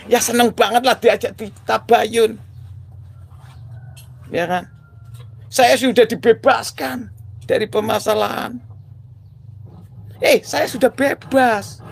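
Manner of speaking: high, exultant boasting; gleeful high voice. Real, not acted. Telling viewers he is free now, gleeful and high.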